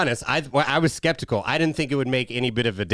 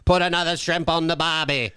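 higher-pitched mock Aussie catchphrase; bad Australian accent; 6 semitones higher than usual. Shown as a demonstration. Trying Australian for his co-host, bad Australian accent. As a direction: have another go at hammy Australian. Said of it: "I can't fucking do Australian".

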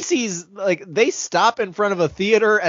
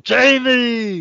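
mock heroic call, drawn out; 6 semitones higher, 4 dB louder than usual. Hero picks out Jamie from the parade, calling out. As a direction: stretch the name into a big call.

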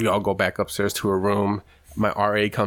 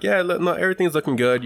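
higher, breezy reassuring voice; mock cheery doctor; 9 semitones higher, faster than usual. Doctor reassuring patient before popping the knuckle. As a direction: sell it brightly to distract him.

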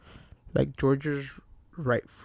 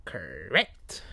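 Pennywise; 4 semitones higher than usual. A narrator's reading. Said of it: "clown introduces himself as Pennywise".

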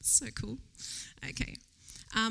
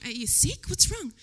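higher and louder, alarmed questions; worried mum; 6 semitones higher, 6 dB louder than usual. Retold or imitated. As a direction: rush to check on the child. Mum finds crying child.

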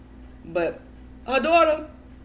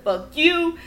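loud, higher angry dismissal, YOU hit hard; retold going off; 6 semitones higher, 5 dB louder than usual. Niece retelling fight with sick aunt, going off. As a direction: cut your aunt off angrily, as retold. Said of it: "I went off on".

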